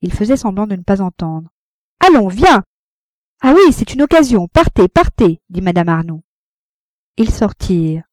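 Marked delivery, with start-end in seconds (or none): 2.0-2.6 high, loud command; impatient urging. A narrator's reading. Rosanette telling Frédéric to come away, impatiently insistent. Rival urging lover to leave. 3.4-5.4 high, loud, stung send-off; bitter dismissal. A narrator's reading. Wife sending lover off with his mistress, bitterly.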